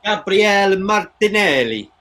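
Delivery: rolling the name like an Italian commentator; Italian accent. Acted. Watching the match with a mate, calling the substitute in a mock Italian accent. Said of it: "Why did I say that in an Italian accent?".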